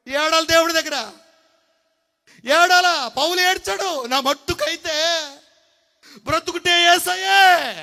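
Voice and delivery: high, loud impassioned sermon cry; fiery preaching. Acted. Pastor urging the church to weep before God, loud impassioned cry. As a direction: cry it out to the crowd.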